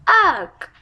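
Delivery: high disgusted groan; disgusted ugh. Acted. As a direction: recoil with a loud ugh. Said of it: "she cried".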